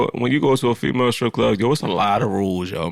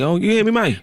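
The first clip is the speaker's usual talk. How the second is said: high-pitched mock Atlanta accent; 12 semitones higher than usual. Man mimicking the guys she likes, mock Atlanta accent. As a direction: imitate an Atlanta man's talk, pitched way up. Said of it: "don't even know what the fuck she saying. Like".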